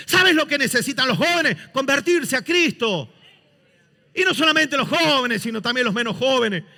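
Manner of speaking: loud, high, impassioned pulpit preaching; fiery preaching. Acted. Preacher rousing his church, loud fiery sermon.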